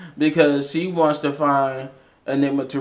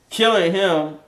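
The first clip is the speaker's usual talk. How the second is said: ordinary delivery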